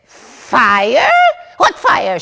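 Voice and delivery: loud, exasperated retort; Mama Yenta scolding the servant girl, shouting; scornful shout. Acted. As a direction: snap back at the girl, scolding. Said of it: "shouts Mama Yenta at the girl".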